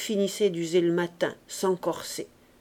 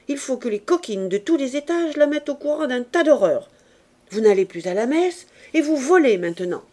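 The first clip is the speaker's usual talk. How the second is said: furious tirade; 2 semitones higher, 7 dB louder than usual. A narrator's reading. Mistress explodes at the insolent maid, furious loud tirade. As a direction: explode at her, full volume.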